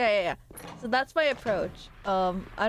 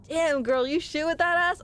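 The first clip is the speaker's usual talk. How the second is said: higher-pitched, put-on flirty compliment; mock flirtatious; 7 semitones higher than usual. Trying out a compliment on a woman, playfully flirtatious. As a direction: toss out a cheeky come-on. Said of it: "coming off as so flirtatious".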